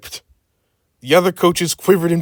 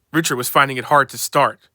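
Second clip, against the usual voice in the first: usual speaking voice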